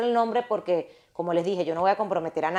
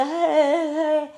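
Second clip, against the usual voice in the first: nervous laugh; 8 semitones higher, 3 dB louder than usual. Real, not acted. Laughs at the memory.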